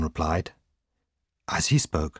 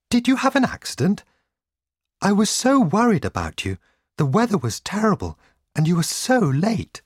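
high-pitched, anxious outpouring; worried cry; 13 semitones higher, 3 dB louder than usual. A narrator's reading. Wife waiting through the storm greets husband, crying out. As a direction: fret aloud in a high voice. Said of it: "she cried".

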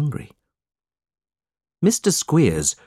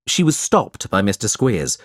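plain reading voice

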